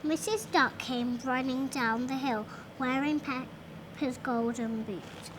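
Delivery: ordinary delivery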